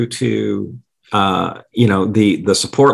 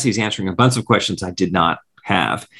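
ordinary delivery

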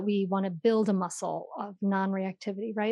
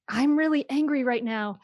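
lightly raised, half-hearted yell; mild mock yell; 4 semitones higher than usual. Mother explains naming anger to her child, mild mock yell. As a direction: quote the shout without fully shouting. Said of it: "But to be able to yell".

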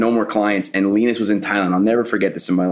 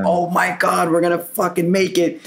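overjoyed; 9 semitones higher than usual. Retold or imitated. Tearful relief after saving client.

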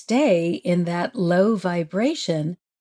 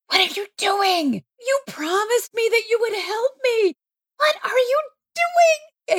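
very high, mock-outraged squeal; hammed-up indignant protest, pitch climbing; exasperated scolding; 14 semitones higher than usual. Acted scolding of Jesus.

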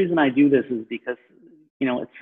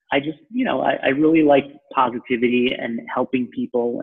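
normal talking voice

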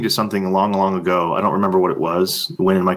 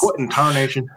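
put-on old-timer exclamation; 4 semitones higher than usual. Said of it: "voicing a puzzled listener".

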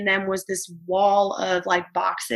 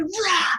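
mock rage roar; 11 semitones higher than usual. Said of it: "And he's going crazy".

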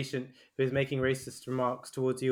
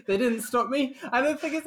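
laughing; 10 semitones higher than usual. Real, not acted. Repeats line still laughing.

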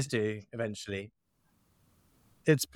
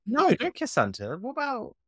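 put-on childish protest, pitched up; indignant child; 9 semitones higher than usual. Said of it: "really indignant, being like".

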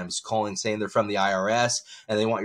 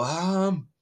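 lightly voiced old man, higher; hint of an old man hesitating; 9 semitones higher than usual. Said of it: "I usually do an old man voice".

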